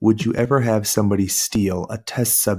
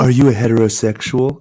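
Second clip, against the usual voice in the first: mock flirty lady; 3 semitones higher, 5 dB louder than usual. Mocking the lady's flirty question.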